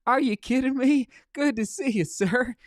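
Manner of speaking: can't believe who showed up; thrilled disbelief. Real, not acted. Thrilled greeting to a viewer.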